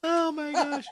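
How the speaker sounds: high breathless laughing through words; can barely talk for laughing. Real, not acted. Laughing fit while trying to speak.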